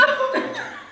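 squealing laugh; high laugh. Real, not acted. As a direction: burst into high giggles. Laughing at a joke.

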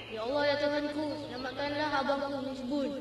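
hushed prayer. Acted. Boy prays for lost brother's safety, praying softly. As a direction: pray softly for someone's safety. Kita minta pada Tuhan.

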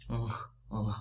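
"Ng" hummed softly through the nose; nasal ng. Shown as a demonstration. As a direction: let the air out the nose on "ng". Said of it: "hơi phải ra từ mũi".

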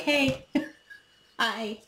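high, playful, silly hello; goofy greeting. Retold or imitated. Immature person greets you casually, a touch silly. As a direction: act like nothing happened.

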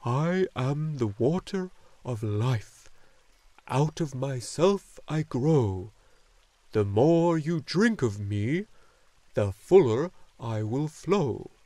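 very slow, resonant proclamation with long-held words; slowly repeating. A narrator's reading. Luck dragon relaying the water's words to Atreyu, slowly repeating. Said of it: "slowly repeated what he heard".

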